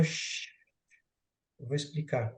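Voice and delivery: a soft shush, then calm words below the noise; quiet the room like a patient king; hushing softly. Acted. Rei acalma a multidão barulhenta, falando baixinho. King hushes the noisy crowd.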